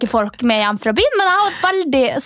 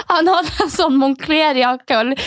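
ordinary talk

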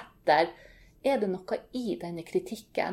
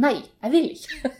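mock roar; 5 semitones higher, 5 dB louder than usual. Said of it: "så brøler datteren min".

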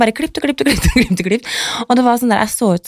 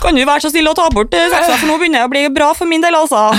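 higher, meek little plea; timid plea; 8 semitones higher than usual. Retold or imitated. Shy customer begging the barber to put the scissors away, meek higher plea. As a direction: squeak out a polite request to stop.

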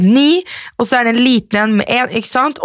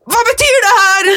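baffled exclaim; 12 semitones higher than usual. Retold or imitated. Mother stumped by her child's maths homework, baffled cry. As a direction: yelp it out, totally lost. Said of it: "og jeg bare".

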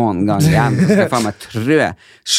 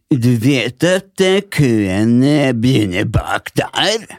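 slow, drawn-out mock of a smug old man; much slower than usual. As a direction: drag it out like a condescending old geezer. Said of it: "så står han der". (treg, hånlig etterligning av gammel gubbe)

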